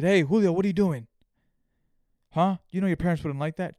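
put-on loud scolding call to a kid; mock neighbor catching him misbehaving; busybody neighbor calling out to a kid.

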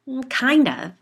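high, loud eager answer; bubbling over with enthusiasm; excited. A narrator's reading. Gwen answers about her ghost detector, eager and excited. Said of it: "she said excitedly".

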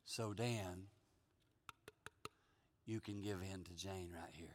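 very low, hushed voice of the Lord; gentle instruction. Acted. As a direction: counsel softly, leaving long pauses. The Lord gently tells him to yield.

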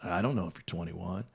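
lightly voiced stoner, low and soft; Spicoli. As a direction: mumble it like an apologetic stoner. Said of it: "imagine a Spicoli voice".

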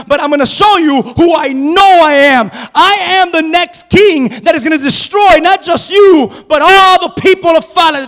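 loud, slow, defiant declaration; defiant shout. Acted. David shouts his kingship at Goliath, defiantly. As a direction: shout him down like a future king.

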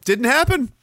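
usual speaking voice

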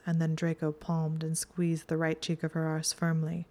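plain reading voice

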